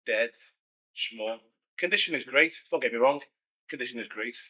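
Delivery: ordinary talk, nothing marked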